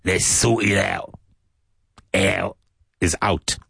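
Cockney sort it out, t dropped; Cockney accent. Shown as a demonstration. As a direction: say it like a Londoner from the East End. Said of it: "very Cockney English".

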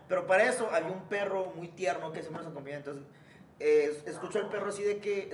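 ordinary talk, nothing marked

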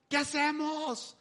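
high, slow panicked cry; a frightened plea for help; panicked disciples. Retold or imitated. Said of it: "los discípulos histéricos". (pregunta aguda y asustada)

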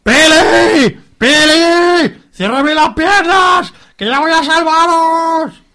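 high, drawn-out yell; heroic calling out. Acted. Javi running to rescue his pregnant love, calling out. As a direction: holler it slowly across a distance.

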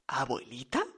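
low, soft, rising question; hushed doubt. A narrator's reading. Señora Smithy seeing the supposed grandmother, hushed doubt. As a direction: barely voice it, unsure.

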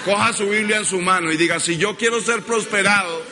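ringing, high preacher declaration; full-voiced pulpit delivery; preaching call-and-response. Real, not acted. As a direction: lead the congregation phrase by phrase. Preacher leading congregation in declaration.

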